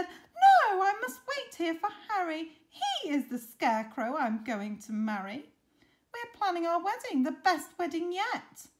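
high, loud protest; proud bride-to-be scarecrow; indignant Betty. Acted. Betty refuses Reginald, defends her wedding.

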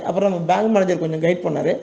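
usual speaking voice

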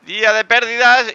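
big frustrated shout, high and loud; loud outburst. Real, not acted. Player yells at his bad luck, loud frustrated shout. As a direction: belt it out in mock despair at the loss. Losing streak, outburst at table.

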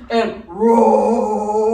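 loud lion roar on the words. Acted. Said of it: "puffed out his chest".

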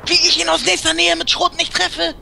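shrill-free high rant, words tumbling; high-pitched disbelief. Real, not acted. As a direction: cry out in disbelief at the miss. Missed shot, high pitched rant.

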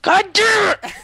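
high exasperated cry with a laugh; laughing frustration. Real, not acted. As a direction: curse while laughing. Loses again, laughing.

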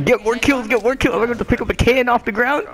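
high, urgent egging-on; urgent game excitement. Real, not acted. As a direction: urge the teammate on, pitched right up. Urging teammate mid-match.

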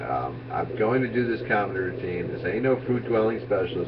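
usual speaking voice

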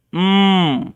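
"উম" as a long closed-lip mmm through the nose; nasal M sound. Shown as a demonstration. Teacher showing learners the M sound, a held nasal hum. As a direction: hum "উম" with no vowel in it. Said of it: "নাক দিয়ে পুরো সাউন্ডটা বেরোবে".

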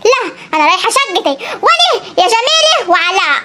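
a granny swearing revenge; scheming grandmother. Acted. Grandmother threatening Jamila and Alaa, low and scheming.

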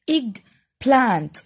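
"eggplant" as EGG - PLANT with a gap; teacher sounding out "eggplant" by syllable; split into two syllables. Shown as a demonstration. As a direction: say "eggplant" in two deliberate beats. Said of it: "بنطقها على مرتين".

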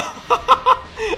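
squealing laugh; high burst of laughter. Real, not acted. Laughs at own mistake.

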